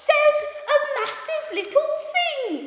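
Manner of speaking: hammed-up British accent, high and loud; mock a terribly overdone British accent, drawn out slowly; over-the-top fake British exclamation; worst British accent. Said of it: "do the worst British accent in the world".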